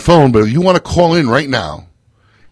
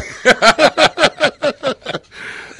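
long high-pitched laugh. Real, not acted. Host laughing after teasing about co-host, high long laugh. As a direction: burst into a drawn-out high cackle. Laughing at own cry joke.

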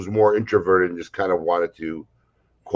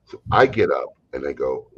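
usual speaking voice

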